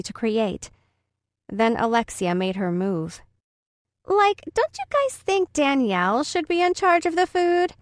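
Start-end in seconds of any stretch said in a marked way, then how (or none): 4.1-7.7 put-on high, sneering bratty girl; mean girl voice. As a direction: taunt like a spoiled schoolgirl. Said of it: "Alexia said loud enough for Danielle to hear".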